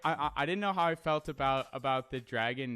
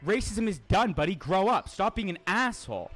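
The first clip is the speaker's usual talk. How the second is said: loud, heated telling-off aimed at chat; angry rant; 5 semitones higher, 6 dB louder than usual. Real, not acted. Streamer berating chat trolls, loud angry rant. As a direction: yell it at them, fed up and disgusted.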